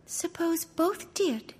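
high, slow, timid question; humbly. Acted. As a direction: ask meekly, afraid of the answer. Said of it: "asked the poor princess humbly".

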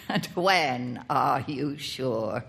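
put-on an indulgent uncle teasing the boy; amused uncle. A narrator's reading. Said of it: "said the uncle, laughing".

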